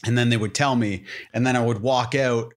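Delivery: normal talking voice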